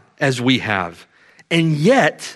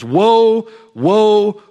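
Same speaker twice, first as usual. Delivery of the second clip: angel announcing; 5 semitones higher, 4 dB louder than usual. Acted. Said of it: "the angel announces".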